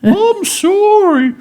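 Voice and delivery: very high, slow whale wail. Acted. Whale servant apologizing to the renamed mistress, whale voice. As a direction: drag the apology out like whale song. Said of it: "in a more whale voice".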